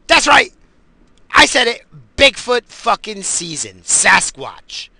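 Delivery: high, loud proud exclamation; triumphant shout. Real, not acted. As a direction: belt it out like a proud reveal. Defiant boast about Bigfoot season.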